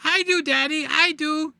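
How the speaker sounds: put-on little kid voice. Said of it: "And the little four-year-old".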